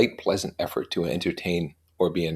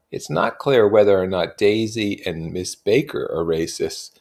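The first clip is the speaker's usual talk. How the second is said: usual speaking voice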